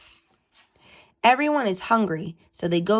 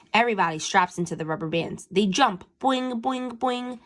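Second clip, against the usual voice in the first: ordinary delivery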